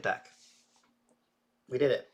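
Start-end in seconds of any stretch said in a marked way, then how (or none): 1.7-2.1 excited. Real, not acted. Celebrating the win.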